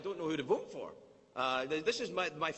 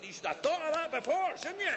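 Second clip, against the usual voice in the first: high mocking taunt with a laugh; Scottish security guard; 10 semitones higher than usual. Guard taunting the burning terrorist, high mocking voice. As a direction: gloat over him while punching. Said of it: "He said".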